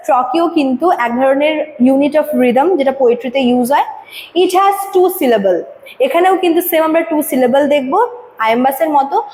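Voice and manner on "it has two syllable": slow, deliberate textbook read-out; slow reading aloud. Acted. Teacher reading the definition to students, slow and deliberate. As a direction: read the definition slowly for the class. Reads textbook line slowly.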